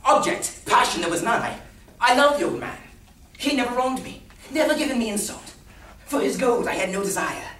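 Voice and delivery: high, loud theatrical declaiming; impassioned madman pleading his case; vehement protest. Acted. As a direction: protest loudly, pitched well up. Madman protests he had no motive.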